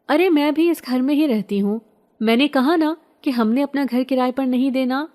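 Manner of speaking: high, loud annoyed snap at the stranger; irritated retort. Acted. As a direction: shut him down, fed up. Arguing with stranger at door.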